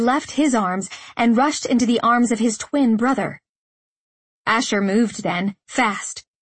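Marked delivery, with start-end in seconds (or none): none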